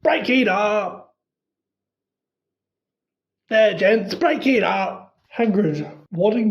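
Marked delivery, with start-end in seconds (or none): 0.0-5.2 slow, booming order; loud. A narrator's reading. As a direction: break up the fight with a big voice. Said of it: "louder than all".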